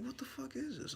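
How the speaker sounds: very high, hushed mock panic; alarmed bewilderment. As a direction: squeak it softly, acting spooked. Retelling shock at hearing the voice.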